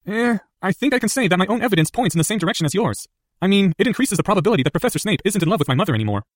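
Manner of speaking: put-on a clever boy weighing his words; earnest schoolboy reasoning; young Harry voice.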